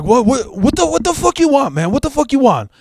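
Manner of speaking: put-on agitated, very high Cheech-style Mexican accent; Mexican accent impression. Thief challenges the bike's owner, defensive Mexican accent. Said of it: "that typical Cheech Mexican accent".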